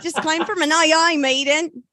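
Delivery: loud, hammed-up Cockney accent; a clumsy stab at Cockney, punchy and loud; bad Cockney accent. Shown as a demonstration. Comic retells her joke to the panel in a bad Cockney accent. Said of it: "That was the worst Cockney accent I've ever done".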